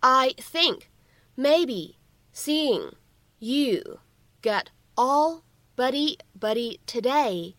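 slow, word-by-word dictation style; slow model reading. Shown as a demonstration. Teacher modelling a long English line for learners, slow reading. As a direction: read it out very slowly, separating each phrase.

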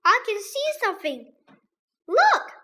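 fast, rising to a high cry on look; excited schoolboy alarm; alarmed discovery. A narrator's reading. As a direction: blurt it out, then yelp. Boy spots figures in the dark.

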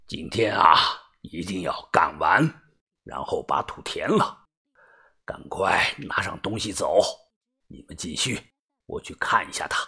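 very low, near-whispered boss voice; leader giving orders. Acted. Boss ordering the crew to finish, low and hushed. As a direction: instruct them softly and low, dropping into whisper. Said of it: "吩咐的说道".

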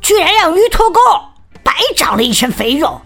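a touch of bossy scolding. Acted. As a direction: tell him off impatiently. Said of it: "数落着小胖墩儿".

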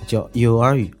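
normal talking voice

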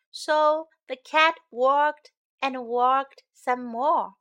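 gentle storybook reading; singsong storytime narration; reading to children. A narrator's reading. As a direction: read it like a bedtime picture book.

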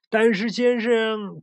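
drawn-out high call after him; slow, pleading shout. Acted. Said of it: "杨副市长大叫道。".

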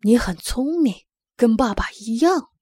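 loud, firm praise with a touch of pride; proud father. A narrator's reading. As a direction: praise your child proudly, punching the words. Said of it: "爸爸骄傲地说". (略带骄傲地大声夸奖)

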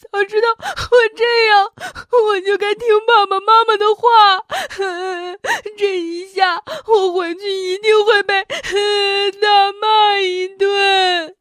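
high, loud crying, slowing at the end; sobbing regret. Acted. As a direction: sob out the regret. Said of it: "小袋鼠越哭越伤心".

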